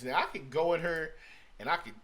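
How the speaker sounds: ordinary talk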